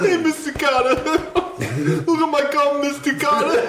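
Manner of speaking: high, drawn-out goofy impression; John Travolta. Co-host playing Travolta calling his teacher, high laughing impression. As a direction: stretch it high while near laughter. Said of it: "more of a John Travolta".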